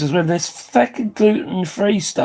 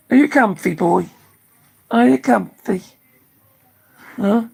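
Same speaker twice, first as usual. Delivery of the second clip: pet talk; 5 semitones higher than usual. Real, not acted. Cooing to the dog.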